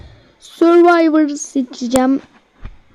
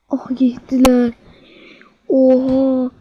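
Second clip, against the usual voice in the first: ordinary talk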